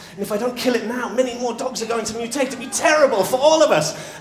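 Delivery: high, loud alarm to a crowd; urgent warning. Acted. Man with shovel warns crowd about mutant dogs, urgently. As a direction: build to a loud, high plea.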